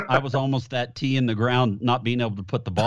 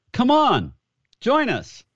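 much higher, louder mock call; golf pros calling; 13 semitones higher, 4 dB louder, slower than usual. Telling how the pros invited him to play, in a high mock call. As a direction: sing out the invitation like a pal across the tee.

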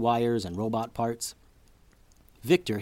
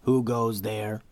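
slow, stiff robot voice; halting guard robot challenge; slower than usual. A narrator's reading. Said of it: "said the stout robot".

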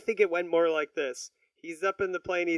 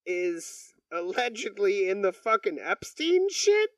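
ordinary talk, nothing marked

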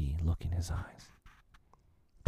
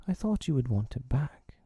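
mildly bitter, pitched up; a little bitter; 6 semitones higher than usual. A narrator's reading. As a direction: let a touch of hurt pride show. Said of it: "he said a little bitterly".

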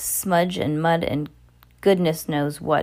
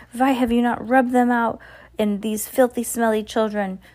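higher, outraged witch railing at her followers; mock scream, moderately raised; screamed demand; 6 semitones higher than usual. A narrator's reading. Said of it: "she screamed".

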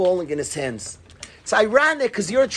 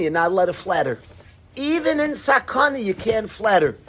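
normal talking voice

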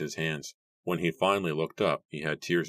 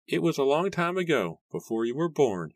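put-on weak old man; 10 semitones higher than usual. A narrator's reading. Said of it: "he told Mary weakly".

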